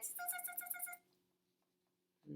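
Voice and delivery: quick repeated tongue clicks; chattering monkey tsks; monkeys' tsk-tsk. A narrator's reading. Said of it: "and they said".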